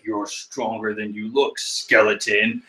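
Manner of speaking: low, soft, slow menace; Katakuri. Acted. Rival in a staredown with a skeleton, low and slow. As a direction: size up your rival in a low voice. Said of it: "Katakuri looks over at Brook".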